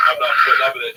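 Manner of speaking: loud, mock defiant declaration.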